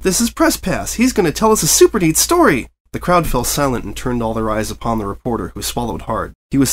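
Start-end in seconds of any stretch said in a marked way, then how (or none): 0.0-2.7 high, bouncy chatterbox pony voice; breathless cheerful pony chatter; bubbly Pinkie Pie. A narrator's reading. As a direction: gush it out like an over-eager party pony. Pinkie introduces the reporter.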